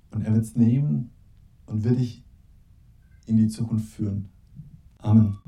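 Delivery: soft, measured words with long pauses; prayerful invitation. Real, not acted. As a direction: gently lead listeners into a moment of silent prayer. Closing invitation to silent prayer.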